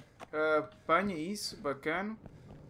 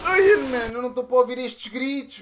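loud, raised complaint; exasperated outburst; 5 semitones higher, 9 dB louder than usual. Real, not acted. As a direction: blurt out the dread. Dreading monster's scream. (desabafo exasperado)

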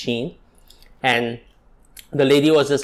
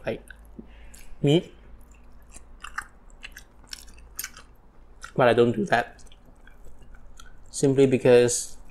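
talking between bites; chewing while talking; 6 dB quieter than usual. Real, not acted. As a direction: mouth partly full. Seen 1: Took a spoonful at -7; lips pursed, chewing while cutting chicken.

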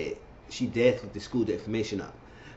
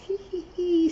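high, sly hee hee hee; a petty snicker, pitched way up; mock gloating giggle. Imitating Kimbella's gloating laugh.

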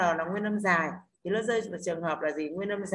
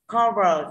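"Cover" drawn out slowly on CO, louder; stress on first syllable; 7 dB louder than usual. Shown as a demonstration. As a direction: punch CO in "Cover", let VER fall. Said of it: "trọng âm rơi tiết thứ nhất là car". (nhấn âm tiết đầu "Cover")

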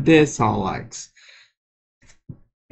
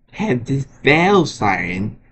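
ordinary talk

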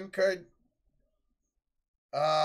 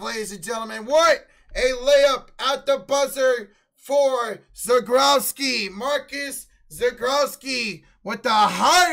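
much higher, much louder announcer yell; excited shouting; 9 semitones higher, 14 dB louder, slower than usual. Acted. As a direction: scream the buzzer-beater like a broadcaster. Buzzer-beater shouted call.

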